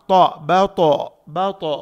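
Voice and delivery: बतो with a thick, rounded t; heavy ta. Shown as a demonstration. Teacher isolates the letter, heavy ta. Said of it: "ता को मोटा पढ़ेंगे".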